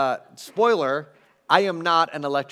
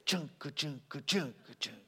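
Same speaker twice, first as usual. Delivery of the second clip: soft, low muttered chunka chunka; imitated guitar strum; 3 semitones lower, 12 dB quieter than usual. Shown as a demonstration. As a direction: mouth the strumming rhythm quietly. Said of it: "Play this".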